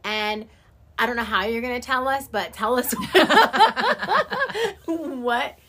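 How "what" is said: laughing high through the words. Real, not acted. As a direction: let the words spill out in a laugh. Laughs through her own request.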